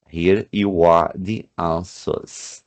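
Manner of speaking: slow teacher reading; slow model phrase. Shown as a demonstration. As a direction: read out very slowly. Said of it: "ó".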